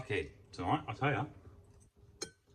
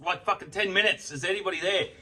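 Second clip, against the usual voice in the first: calling out; 6 semitones higher, 6 dB louder than usual. Retold or imitated. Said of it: "even I called out".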